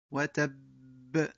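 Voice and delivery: وتب ending in an echoing bounce on ب, slowly; stop with qalqala. Shown as a demonstration. Teacher demonstrates qalqala on the stop. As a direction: let the ب of وتب rebound at the stop. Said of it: "الوقف بالسكون مع القلقلة".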